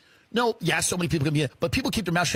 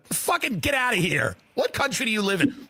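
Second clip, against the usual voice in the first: ordinary delivery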